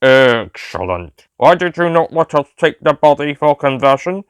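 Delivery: very slow, flat robotic drone; robotic Cyberman voice. A narrator's reading. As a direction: speak like a cold machine, word by word. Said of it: "came another Cyberman's voice".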